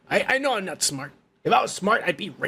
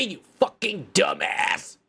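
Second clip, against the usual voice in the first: usual speaking voice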